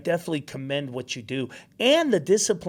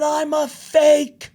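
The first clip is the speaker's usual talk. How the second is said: high, loud, stretched-out mock wail; tearful confession; 12 semitones higher, 6 dB louder, much slower than usual. Son confessing to dad after the concert, retold wail. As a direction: confess it as an imitated crying kid. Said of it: "I'm crying".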